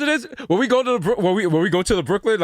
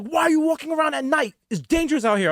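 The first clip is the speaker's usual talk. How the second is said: much higher, fussing auntie voice; aunt fretting over a late walk, voice pitched up; worried aunt; 9 semitones higher than usual. Retold or imitated. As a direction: scold like a worried aunt at night. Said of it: "my aunt was like".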